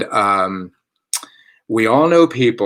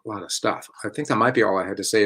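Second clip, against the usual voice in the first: ordinary talk, nothing marked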